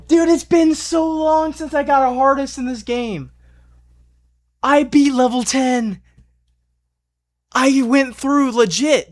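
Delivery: high, excited, still shaking; elated. Real, not acted. Gamer just beat level ten, high excited chatter. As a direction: talk through the adrenaline.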